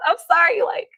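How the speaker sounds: ordinary talk, nothing marked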